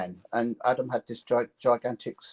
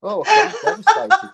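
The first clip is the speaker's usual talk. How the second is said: sarcastic devastation; 10 dB louder than usual. Pretend. Musician sarcastically answering a heckler, hammed-up hurt. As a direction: ham up being wounded by the insult.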